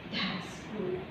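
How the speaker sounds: very soft, low murmur of satisfaction; hushed approval. Acted. God quietly approving his new creation, hushed approval. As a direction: say it softly, almost to yourself.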